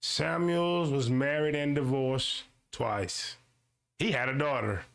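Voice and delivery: slow, deliberate read-aloud; reading the line slowly so viewers catch it. Shown as a demonstration. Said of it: "read it again slowly".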